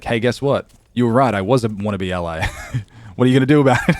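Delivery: loud cheeky announcement; gleeful confession. Retold or imitated. Streamer tells troll he was right, loud and cheeky. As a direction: tease him with a grin. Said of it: "and was like".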